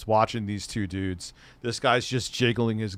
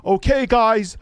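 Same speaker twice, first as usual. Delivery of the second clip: much higher and louder, an excited announcer to his camera; Frankie McDonald impression; 14 semitones higher, 9 dB louder than usual. Imitating a vlogger announcing to his camera, high and loud. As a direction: hype it up high like a late-night vlogger. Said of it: "when he's out at night filming".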